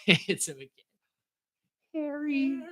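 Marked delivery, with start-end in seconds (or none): 1.9-2.6 mock whimpering call. Marv whining for Harry.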